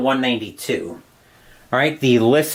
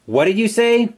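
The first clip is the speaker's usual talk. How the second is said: raised, higher call into the dark; 8 semitones higher than usual. A narrator's reading. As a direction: call out into the darkness. Man calls into the dark.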